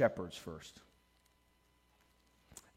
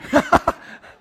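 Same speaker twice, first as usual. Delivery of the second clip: high loud burst of laughter; 14 semitones higher, 16 dB louder than usual. Real, not acted. Pastor laughing that the crying one was the cop, loud laugh. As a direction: crack up at the memory.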